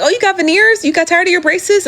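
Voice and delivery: high mock surprised voice, rising at the ends; mocking people. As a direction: squeak it like a nosy stranger. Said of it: "people were like".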